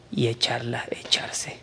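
whispered warning, voice dropping to nothing. Acted. Preacher whispers the Bible's warning about millstones. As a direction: hush it like a grave threat.

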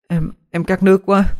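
high-voiced thirsty child; small high voice, hesitant start; sick child. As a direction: plead for water in a high child's voice.

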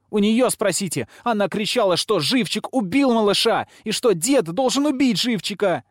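high-pitched heated accusing; outraged girl's cry; shrill accusation. A narrator's reading. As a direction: fling the blame at her, very high. Girl accusing Lilia.

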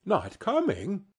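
high, incredulous rising question; dismayed question. A narrator's reading. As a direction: ask it as if your spirits just dropped. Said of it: "with a sudden declension in his high spirits".

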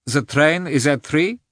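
lightly voiced foreign workman, checking a time; German mechanic. A narrator's reading. German mechanic's question in narrated dialogue.

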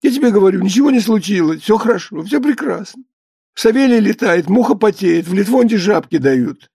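high, whining, rattled insistence; tearful cry. A narrator's reading. Flustered husband babbling to his wife, tearful high voice. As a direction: protest plaintively that nothing is wrong. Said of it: "закричал он плачущим голосом".

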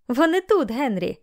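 high-pitched call to someone nearby. A narrator's reading. Mother calls to her husband on entering the barn, calling out. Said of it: "крикнула вона".

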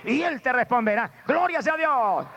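high, ringing exhortation; full-force preaching. Acted. Preacher urging the congregation to cry out to God, full-force preaching. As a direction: urge the congregation on at a high pitch.

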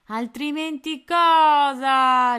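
slow, loud "Altrimenti cosa?" leaning on cosa; mock him with a slow threat; menacing pirate. A narrator's reading. Pirate mocks Tony's threat, slow and menacing. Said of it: "con aria minacciosa".

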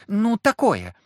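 high, loud piping voice; young child. A narrator's reading. Girl describes the carriage emblem, in a piping voice. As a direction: pipe up like an eager child. Girl explains her drawing.

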